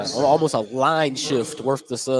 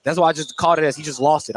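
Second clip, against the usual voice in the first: ordinary talk, nothing marked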